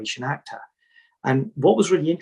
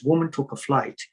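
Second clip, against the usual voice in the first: usual speaking voice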